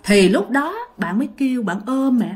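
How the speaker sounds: ordinary delivery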